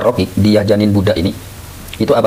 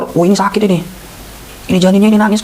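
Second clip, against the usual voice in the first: higher, worried doctor's voice; teacher voicing a doctor, pitched up and concerned; 10 semitones higher than usual. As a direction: play the doctor alarmed at the crying baby. Doctor voiced in lesson story.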